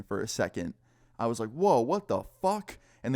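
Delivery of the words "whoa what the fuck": high, slow stunned whoa; shocked exclamation. Retold or imitated. Walking in on Jake's dad with a girl, stunned and slow. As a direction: drag out the disbelief as you walk in.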